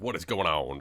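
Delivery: ordinary delivery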